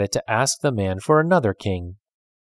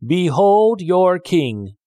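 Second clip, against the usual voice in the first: slow, booming, higher announcement; grand proclamation; 9 semitones higher, 5 dB louder, much slower than usual. A narrator's reading. Farmer presents a log to the frogs as king, booming. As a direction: present the log like royalty, drawn out. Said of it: "he called".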